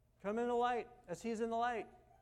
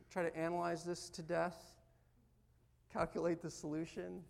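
usual speaking voice

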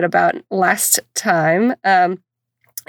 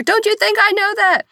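retold scream; 12 semitones higher than usual. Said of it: "I'm screaming at her".

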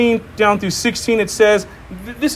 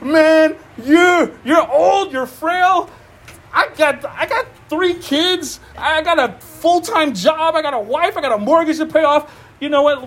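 higher, brushing-off voice; dismissive adult child; 7 semitones higher than usual. Retold or imitated. Busy adult child brushing off an aging parent, mock dismissive. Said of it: "We're like".

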